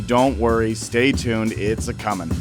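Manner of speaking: normal talking voice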